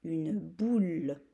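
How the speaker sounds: une boule drawn out very slowly; slow dictation. Shown as a demonstration. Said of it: "un mot spécial Noël". (une boule dictée très lentement)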